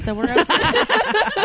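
high, slow, laughing delivery. Real, not acted. Guest joking back to the co-hosts, laughing. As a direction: crack up as you finish the joke.

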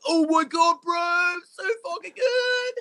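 very high-pitched squealed gushing; mock drunken rave. Imitating a friend raving to the food-window guy, high mock gushing. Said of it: "And Aaron was like".

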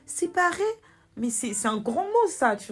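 mock-shocked father, high-pitched query; imitated incredulous question; shocked echo. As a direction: echo it back high, in disbelief. Father's shocked reaction, retold.

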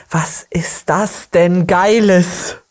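slow, drawn-out marvelling; very slow, savoured disbelief; awestruck amazement. Real, not acted. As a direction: stretch each word out in amazement. Awestruck reaction to the clip.

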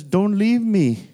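slow, pleading, imitating a crying young woman; retold tearful plea. Pastor retelling Haley begging him on the phone, mild tearful plea. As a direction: draw it out like a tearful plea, lightly voiced. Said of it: "'cause she was crying".

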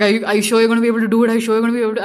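mock-anxious dad, words tumbling out; fretting dad. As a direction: imitate a panicking parent firing questions. Imitates dad fretting about her show.

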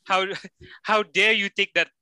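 ordinary talk, nothing marked

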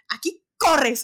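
urgent call. Retold or imitated. Shouting to friend to run.

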